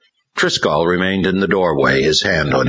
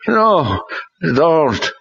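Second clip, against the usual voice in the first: put-on refusing boy; 12 semitones higher than usual. A narrator's reading. Ron refusing, demon-driven.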